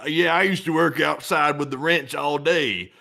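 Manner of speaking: slow, drawn-out fake Southern drawl; mock Southern accent. Politician pretending to Southerners he's a ranch hand, mock Southern accent. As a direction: put on a Southern farmhand accent. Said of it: "like, in the South".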